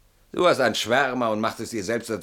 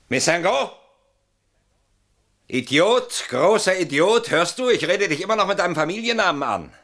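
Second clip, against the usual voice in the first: high, loud jeering at his friend; bitter shouted mockery, higher and louder; mocking outburst; 9 semitones higher, 5 dB louder than usual. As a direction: taunt him, voice pitched up and loud.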